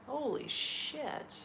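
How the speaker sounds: slow, soft amazed gasp of words; stunned. Real, not acted. Host learns the weed pizza is legal, stunned and soft. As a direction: let the shock sink in slowly.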